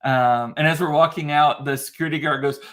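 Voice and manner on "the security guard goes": normal talking voice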